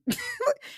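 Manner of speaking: high laugh. Real, not acted. Laughs after reassurance.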